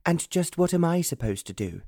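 high-pitched, indignant demand; crying out in protest. A narrator's reading. Sister about to be left behind, crying out in protest. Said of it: "she cried".

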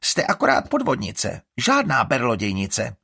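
loud, high scornful taunt; mocking contempt. A narrator's reading. Iris mocking Estrid before vanishing. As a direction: sneer insults at the old woman. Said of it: "posměšný úšklebek".

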